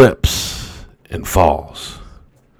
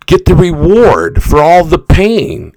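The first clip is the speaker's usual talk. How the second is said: fired-up shout; 8 semitones higher, slower than usual. Retold or imitated. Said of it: "Eric Thomas goes".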